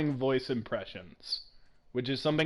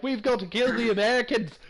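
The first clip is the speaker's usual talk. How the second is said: put-on a second lazy, lightly done Scottish accent, pitched higher; a weak Scottish battle cry at Americans; 8 semitones higher than usual. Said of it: "They come over here like".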